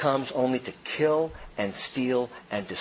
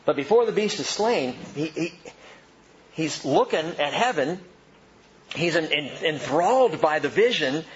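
normal talking voice

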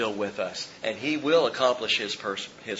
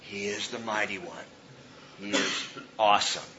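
a touch softer, reverent awe; hushed awe; 4 semitones lower, 8 dB quieter than usual. Pretend. A believer pausing to revere God, hushed awe. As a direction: say it in quiet wonder. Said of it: "stop and pause every now and then and say".

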